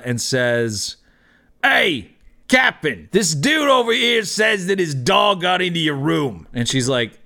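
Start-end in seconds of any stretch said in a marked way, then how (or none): 1.6-6.5 high-pitched holler to the boss; hammed-up bouncer reporting trouble; bouncer calling out. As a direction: call across the bar to your captain. Bouncer calls to the captain.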